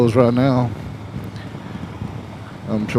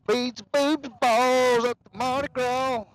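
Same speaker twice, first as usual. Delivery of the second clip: very high, softer falsetto chant; playful high voice; 12 semitones higher, 7 dB quieter than usual. Pretend. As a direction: squeal out a silly Mardi Gras call, softly. Playful Mardi Gras beads chant.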